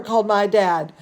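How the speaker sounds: ordinary delivery, nothing marked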